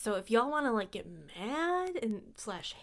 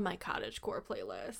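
whispering; 5 semitones lower, 11 dB quieter than usual. Acted. Teasing whispered threat.

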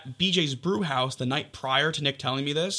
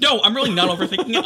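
quick, louder, higher protest; retold panicked insistence; 6 semitones higher, 6 dB louder, much faster than usual. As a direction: rush it out like you're panicking.

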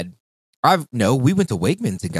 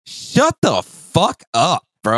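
slow, high, louder drawn-out disbelief; mock disbelief; 8 semitones higher, 5 dB louder, much slower than usual. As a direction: stretch it out, pitched up, like you can't believe your ears. Retold disbelief at coworker.

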